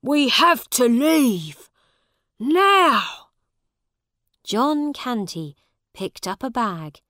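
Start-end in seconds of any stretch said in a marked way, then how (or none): 0.0-3.3 loud, raised-pitch order; urgent command. A narrator's reading. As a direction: order everyone out, climbing on the last word. Father urges family to flee.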